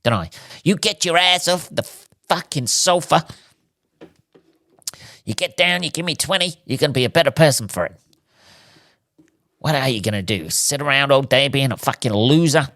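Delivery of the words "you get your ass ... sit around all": higher, pushy fitness coach bark; TikTok fitness guru. Pretend. Mocking TikTok fitness videos, a hammed-up drill-coach push. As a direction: hype up the viewer like a gym influencer. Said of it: "I sound like one of these people making a fucking TikTok videos about staying fit".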